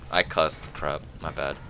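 normal talking voice

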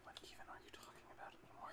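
fast hushed whisper. Real, not acted. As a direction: whisper it quickly under the breath. Quiet game chatter aside.

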